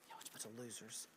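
low, very soft, quick mutter; muttered aside. Real, not acted. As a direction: toss it away under your breath.

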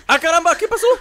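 high, loud startled exclamation; startled outcry. Real, not acted. Player caught off guard by sudden attack, startled outcry. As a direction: blurt it out in alarm.

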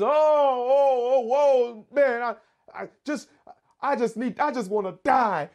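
high, groaning, worn-out prophet; despairing Jonah. Acted. Jonah groaning in the hot east wind, wanting to die. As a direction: moan like a man who wants to die. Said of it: "He was".

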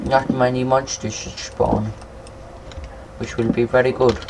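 usual speaking voice

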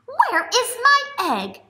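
teacher voicing the bunny; worried bunny. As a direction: ask it as a fretting bunny. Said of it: "said the bunny".

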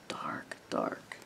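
fading into a low whisper; reading the dark night to children in a fading whisper; spooky whisper. Acted. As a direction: let the darkness swallow the words.

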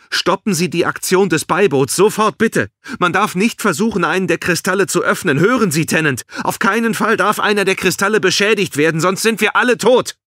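very high, loud, frantic warning; panicked shouting. A narrator's reading. Balin begs superior to halt mission, panicked shouting. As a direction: shout as if all lives depend on it. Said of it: "schrie Balin".

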